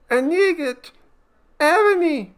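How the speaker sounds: very high, loud frightened cry; terrified cry. A narrator's reading. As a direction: cry out shrilly into the dark. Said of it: "I cry into its darkness".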